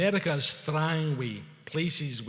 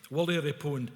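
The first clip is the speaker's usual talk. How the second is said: ordinary delivery, nothing marked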